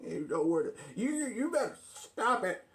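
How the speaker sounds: rising threats, ending in a shout; threaten, then yell the last line; arguing with myself. Acted. Argument escalates to threats.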